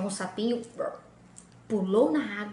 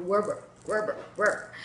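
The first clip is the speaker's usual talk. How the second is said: low croaking frog noises; frog croaks; 5 semitones lower than usual. Acted. Frog hops away croaking.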